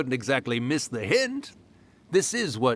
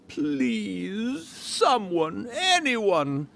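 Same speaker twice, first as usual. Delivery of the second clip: higher, lightly voiced desperate writer; mock plea for help; 8 semitones higher, slower than usual. Spirit retelling the writer's cry, lightly mock pleading. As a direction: imitate a pleading voice. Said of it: "heard someone yelling".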